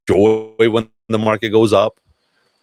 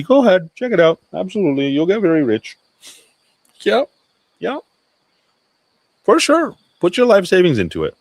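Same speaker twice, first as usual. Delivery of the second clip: higher, mock-eager go-ahead; sarcastic encouragement; 11 semitones higher than usual. Warning listeners off hype coins, in mock-eager sarcasm. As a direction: egg them on with obvious sarcasm.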